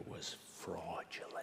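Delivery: dropped to a whisper; hushed reveal. Real, not acted. Telling the audience what newspapers said about his paper, hushed reveal. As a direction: whisper it like a scandalous accusation.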